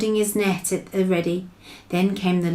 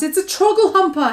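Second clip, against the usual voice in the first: high, loud furious shout; anguished shout; 11 semitones higher, 5 dB louder than usual. Acted. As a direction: shout the name of the nightmare. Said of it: "he shouted".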